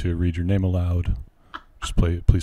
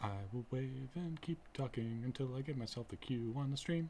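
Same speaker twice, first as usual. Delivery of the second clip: quiet; 7 semitones higher, 18 dB quieter than usual. Real, not acted. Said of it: "I don't think anyone will hear this".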